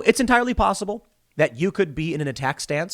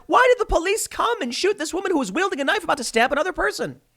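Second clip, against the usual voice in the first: mocking critics; 13 semitones higher than usual.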